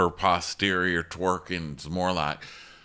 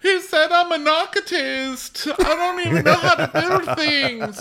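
very high, loud wail; hammed-up sobbing squeal with a laugh creeping in; mock crying; 6 dB louder than usual. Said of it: "she's crying to her".

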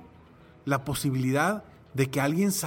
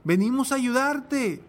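higher, steady rescue call; coast guard calling; 7 semitones higher, slower than usual. Retold or imitated. Coast guard calls to the drowning man, higher steady call. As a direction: offer help, firm and clear.

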